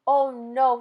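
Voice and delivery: loud cry of dismay; a sudden loud groan of alarm. A narrator's reading. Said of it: "he cried".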